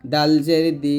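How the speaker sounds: ordinary talk, nothing marked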